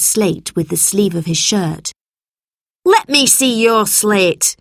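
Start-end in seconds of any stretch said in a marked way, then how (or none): 2.8-4.6 slow, higher-pitched teacher's order; shouted demand. A narrator's reading. Teacher demands Albert's slate, shouted. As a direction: demand it in a raised voice. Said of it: "she shouted".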